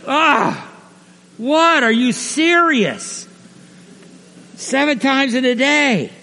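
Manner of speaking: high, drawn-out disbelief; incredulous. Acted. Apostles react to forgiving seven times, incredulous. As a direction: protest as if it's an impossible demand.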